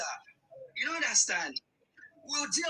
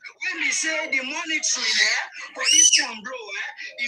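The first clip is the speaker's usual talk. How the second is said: loud shout; 2 semitones higher, 8 dB louder than usual. Real, not acted. Heated debate, loud claim.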